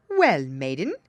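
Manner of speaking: loud, sudden snap at her; snapped out. A narrator's reading. King Frost confronting the girl, snapped out. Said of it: "he snapped out".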